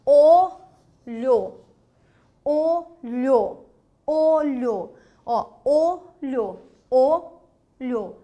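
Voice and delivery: olho drawn out loud with an open oral o; oral vowel demo. Shown as a demonstration. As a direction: say olho slowly, no nasal sound. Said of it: "quando você diz".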